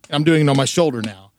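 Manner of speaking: usual speaking voice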